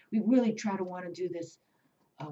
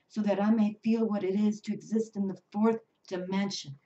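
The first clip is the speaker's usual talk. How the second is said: louder, earnest prayer read; prayerful invocation; 3 semitones higher, 7 dB louder than usual. Acted. Reads the angel's invocation.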